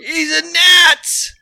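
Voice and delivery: high, drawn-out accusing yell; hammed-up shout of accusation; mock alarmed shout. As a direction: cry out as if exposing an impostor. Acted outing of the fake Canadian.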